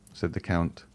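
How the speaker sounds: plain reading voice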